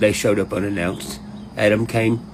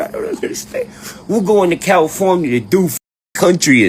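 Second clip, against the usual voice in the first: high, louder mock quote; mocking imitation; 6 semitones higher, 3 dB louder than usual. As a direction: parody them in a raised voice. Mimics rappers going to California.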